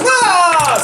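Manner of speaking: sudden high cry as he slips; high yelp of a fall. Acted. Rider falling from his horse, a high yelp. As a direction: yelp as you hit the ground.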